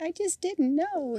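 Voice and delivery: high, slow, sugary plea; mock flirty innocent voice, pitched high and slow; schmoozing coquette. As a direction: bat your lashes at the judge. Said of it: "trying to schmooze the judge".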